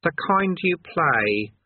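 patient Eric. Acted. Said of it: "said Eric patiently".

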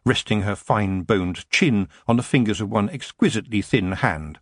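ordinary delivery, nothing marked

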